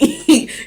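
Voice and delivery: short high laugh; a quick amused chuckle; surprised laugh. Real, not acted. Laughs at card.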